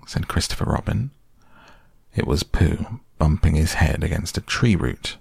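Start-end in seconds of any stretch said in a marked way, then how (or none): none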